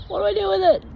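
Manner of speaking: high, exasperated plea; a high, frantic what do I do; exasperated pleading. Retold or imitated. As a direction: beg him for an answer.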